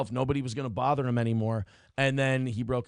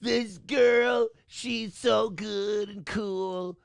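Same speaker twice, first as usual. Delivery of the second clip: very slow, higher whiny emo croon; emo singer voice; 5 semitones higher, much slower than usual. Acted. Mocking emo songs for his cohost, slow whiny emo croon. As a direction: stretch it out like a mopey emo singer. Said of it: "Which is like".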